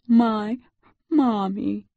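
put-on high, broken child's plea; breath in gulps. A narrator's reading. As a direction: gasp out each word separately like a tearful boy. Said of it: "his breath coming in gulps".

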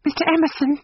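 put-on high-pitched girl's urgent appeal; young woman's pleading exclamation; cried out. A narrator's reading. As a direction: call to him in sudden distress. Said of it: "cried the girl".

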